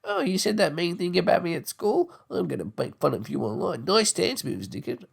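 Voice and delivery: high whiny schoolkid; lightly voiced petty kid; mock kid. Schoolkid threatening online revenge, whiny mock voice. Acted bully exchange.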